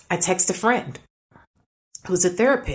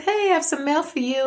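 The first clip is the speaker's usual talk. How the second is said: higher, cheery retold hello; friendly greeting; 9 semitones higher than usual. Texting the neighbors about their mail, friendly higher greeting. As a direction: call it over lightly, pitched up.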